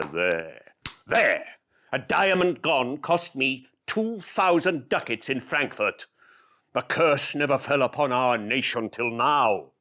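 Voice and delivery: furious, grieving Shylock; Shylock in rage. Acted. Shylock raging over losses.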